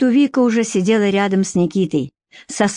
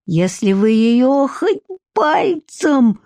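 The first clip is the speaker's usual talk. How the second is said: higher, slow, strained yell of rage; furious threat; 5 semitones higher, much slower than usual. A narrator's reading. Captive Nikita warns the kidnappers off Vika, furious. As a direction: threaten them in fury, drawing the words out. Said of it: "с яростью заорал Никита".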